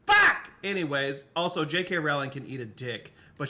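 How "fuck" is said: sudden loud high curse; frustrated shout. Real, not acted. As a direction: blurt it out in frustration. Sudden frustrated outburst at puzzle.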